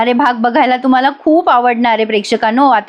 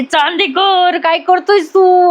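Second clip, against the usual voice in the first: higher, slow, shouted call of his surname; shouting at him; 6 semitones higher, slower than usual. Retold or imitated. Wife catches husband pulling her, shouts his name. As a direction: yell at Chandekar, drawn out and raised. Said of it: "अशीच ओरडते हा ती".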